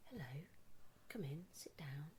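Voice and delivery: peace guru whisper. Retold or imitated. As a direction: whisper it like a serene guru welcoming you. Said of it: "some sort of peace guru".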